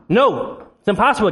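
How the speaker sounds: ordinary talk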